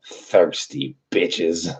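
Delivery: very slow, stretched mocking line; drawn-out sneer. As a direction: drawl it like the skit. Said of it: "He's like".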